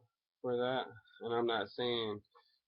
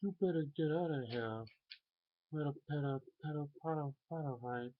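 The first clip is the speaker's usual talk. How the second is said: higher, cartoonish mashed-up voice; Daffy, Butthead and Trump all at once; Daffy Duck Butthead Trump mashup; 6 semitones higher, faster than usual. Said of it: "Daffy Duck, Butthead, and Donald Trump in one".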